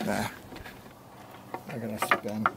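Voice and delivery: ordinary talk, nothing marked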